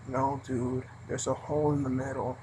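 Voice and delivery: low, soft, flat-voiced no dude; low deadpan snub to a customer; deadpan retort. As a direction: answer it with bored contempt. Annoyed clerk finally answering back.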